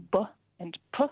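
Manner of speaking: "b and p" said as short sounds "buh" and "puh"; letter sounds. Shown as a demonstration. Linguist explaining sound distinctions, "b and p" voiced as "buh" and "puh". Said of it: "between the sounds".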